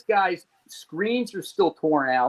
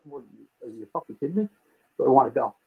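low, muttered disbelief; retold incredulity; 4 semitones lower, faster than usual. Man answers her as he heads out, low incredulous retort. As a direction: toss it back under the breath, incredulous.

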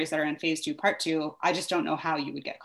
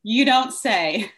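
sarcastic; 5 semitones higher, 8 dB louder, much slower than usual. Real, not acted. Sarcastic reply to school news.

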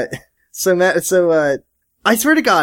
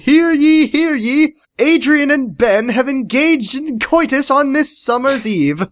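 town crier; 9 semitones higher than usual. Pretend.

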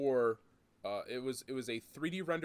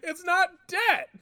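much higher, louder and slow, mocking; mock pompous insistence; mock corporate indignation; 10 dB louder, slower than usual. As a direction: squeal it in mock outrage. Mocking the company's claim.

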